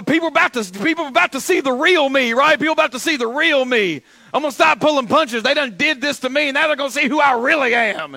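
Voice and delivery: loud, high imitation of an online hothead; mock ranting threat. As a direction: rant as if done holding back. Voices threatening social media posts.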